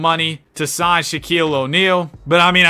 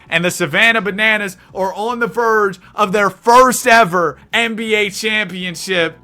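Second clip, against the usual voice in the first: usual speaking voice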